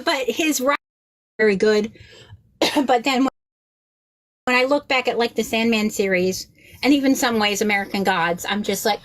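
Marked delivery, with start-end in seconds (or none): none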